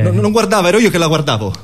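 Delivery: high, frantic protest; panicked denial. Acted. Freud correcting his story to the analyst, frantic high voice. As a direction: hurry to correct himself.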